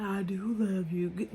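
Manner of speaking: slow, lightly mimicked boyfriend reassurance; slow mock-soothing "I do love you". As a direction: drag it out like a lazy boyfriend soothing her. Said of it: "and he like".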